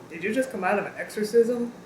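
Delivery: normal talking voice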